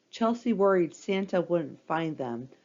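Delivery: plain reading voice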